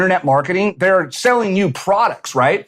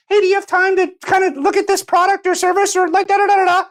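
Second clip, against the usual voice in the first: much higher, whiny sales pitch babble; mock salesman voice; 13 semitones higher than usual. Mimicking a marketer pitching his service, high mock voice. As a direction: parody an annoying DM pitch, high and nasal-free.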